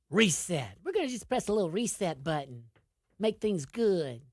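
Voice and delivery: a hint of Arkansas accent, teasing. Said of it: "I got my Arkansas accent today".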